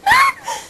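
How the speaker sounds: very high short squeak of laughter; a sudden sharp squeal of a laugh; squeaky laugh. Real, not acted. Laughing fit.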